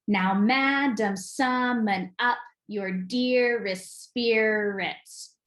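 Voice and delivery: very slow, measured beat, breaking "Now, madam, summon up your dearest spirits." foot by foot; divided into feet. Shown as a demonstration. Teacher dividing a Love's Labour's Lost line into feet, slow scansion. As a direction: split "Now, madam, summon up your dearest spirits." into two-syllable chunks, stressing each second one. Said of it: "go ahead and divide the feet".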